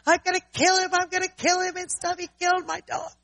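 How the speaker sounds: high, loud furious yelling; retold angry shouting. Kids threatening whoever hurt their dog, high angry yelling. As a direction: rage like the upset kids. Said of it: "And they're start".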